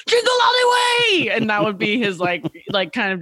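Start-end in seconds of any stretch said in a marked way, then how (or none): 0.1-1.3 shouted Arnold Schwarzenegger impression, much higher; big hammy Arnold yell; Arnold shouting. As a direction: bellow the title like Arnold. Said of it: "Arnold would always guide the conversation".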